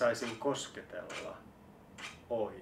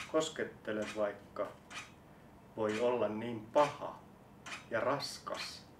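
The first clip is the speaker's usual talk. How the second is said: ordinary delivery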